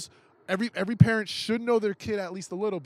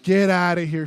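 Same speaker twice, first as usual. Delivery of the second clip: much louder, incredulous; disbelieving outburst; 10 dB louder than usual. Retold or imitated. Hearing the girl lied about him too, loud disbelief. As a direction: blurt it out in disbelief. Reacting to the parents' news.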